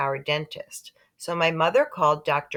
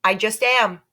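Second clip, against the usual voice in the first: shouting; 5 semitones higher, 6 dB louder than usual. A narrator's reading. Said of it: "my mother shouted".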